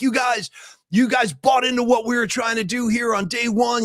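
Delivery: rally the team like a coach; coach speech. Retold or imitated. Coach Vrabel praising his team in a shouted pep talk. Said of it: "the typical coach, like, congratulations thing".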